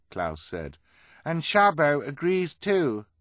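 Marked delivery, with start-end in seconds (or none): 1.2-3.1 very high, loud character voice; put-on high voice. A narrator's reading. Klaus in fake high voice.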